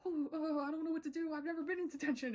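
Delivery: very high, quick anxious babble; nervous panic. Retold or imitated. Gus to Matt in detention, very high nervous babble. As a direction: fret like a rule-following kid. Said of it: "Gus is like".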